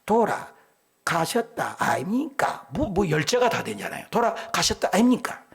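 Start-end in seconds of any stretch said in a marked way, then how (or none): 0.1-2.7 Gyeongsang dialect. Retold or imitated. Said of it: "경상도 사람은". 4.1-5.4 Gyeongsang dialect. Retold or imitated. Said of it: "경상도 사람은".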